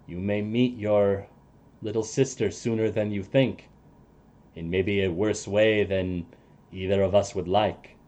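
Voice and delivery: quiet warning from a weary friend; NPC voice. Acted. Ally warns the sister, low and soft.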